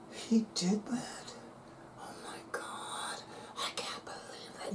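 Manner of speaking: unvoiced, slow mock horrified whisper; shocked whisper. Mocking horrified viewers clutching crosses, whispering. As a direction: whisper in scandalized disbelief. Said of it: "clutching your crosses".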